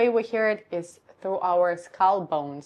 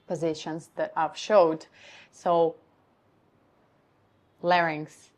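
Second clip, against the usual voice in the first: ordinary talk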